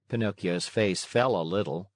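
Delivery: ordinary delivery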